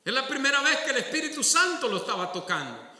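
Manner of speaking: ordinary delivery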